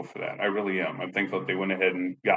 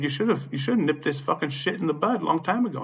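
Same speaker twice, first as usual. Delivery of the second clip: high-pitched, indignant scolding; retold telling-off; 9 semitones higher than usual. Tenant blaming the property manager, indignant. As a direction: re-enact chewing her out, pitched up.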